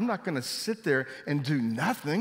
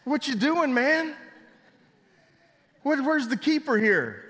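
high, loud incredulous yell at Adam; hammed-up disbelief, calling out to Adam; mock bewildered shout; 12 semitones higher, 6 dB louder than usual. Preacher acting a baffled onlooker shouting at Adam, mock incredulous.

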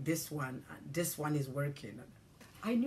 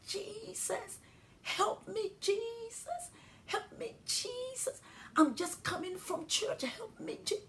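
very high, crying out "Jesus, help me"; pleading in terror, voice cracking high; frantic cry for help; slower than usual. Retold or imitated. Driver in the storm crying to Jesus, high and frantic.

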